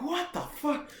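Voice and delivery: high, thin-voiced disbelief; acted bewilderment. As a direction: relive being baffled while toppling over. Toppling over, retold.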